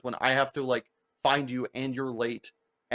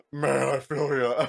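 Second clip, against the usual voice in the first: dropped voice; slower than usual. Retold or imitated. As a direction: drop the voice way down and drawl it. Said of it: "drops two octaves".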